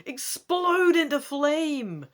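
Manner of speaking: dramatic gamebook narration; story narration. A narrator's reading. Reading the gamebook to viewers, dramatic narration. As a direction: read it out like an adventure story.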